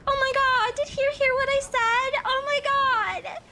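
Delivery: high, loud mock panic; mocking a friend's loud panicked shriek. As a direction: shriek it like a friend who just got caught. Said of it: "And she was like".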